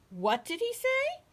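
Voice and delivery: high, loud incredulous question; baffled frogs crying out; astonished cry. A narrator's reading. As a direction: cry it out in disbelief, swooping up at the end. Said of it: "cried the frogs".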